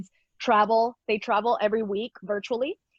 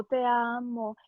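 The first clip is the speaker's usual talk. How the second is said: put-on a baby's slow first words, repeated gently; slower than usual. Baby son repeating his first words to mom, slowly. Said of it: "like, very slow".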